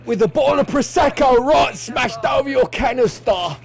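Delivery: high, loud sing-song mock shout. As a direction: belt out the punchline in a comic yell. Punchline about Prosecco bottle smash.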